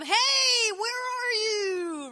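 loud, high, drawn-out call. Acted. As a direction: holler slowly to someone far away. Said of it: "God calls out to them".

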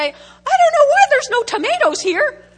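high, loud, put-on voice of a confused farmer; baffled farmer. Pastor voicing a baffled farmer who planted corn, high and loud. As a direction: squeal it out like a clueless farmer staring at his field.

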